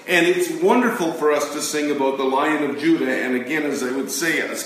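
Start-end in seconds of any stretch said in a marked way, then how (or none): none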